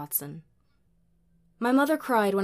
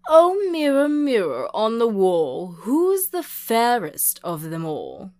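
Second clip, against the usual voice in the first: loud, lilting call to the mirror; ringing out with vain delight; honeyed delight; 4 semitones higher, 12 dB louder than usual. A narrator's reading. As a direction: pour it out like honey, high-flung opening.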